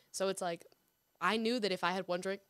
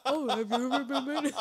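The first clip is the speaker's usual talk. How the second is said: higher put-on voice through laughter; laughing mimicry; 4 semitones higher, 4 dB louder than usual. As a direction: crack up while mimicking the line. Mocking the impression back while laughing.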